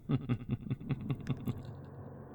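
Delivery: a quiet, cruel laugh to himself; sinister chuckle. Acted. The cult chuckles at the caged filly, cold and cruel.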